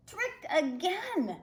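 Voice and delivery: storybook fury, drawn out; raging. Acted. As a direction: spit it out in mock rage. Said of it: "the king of sharks raged".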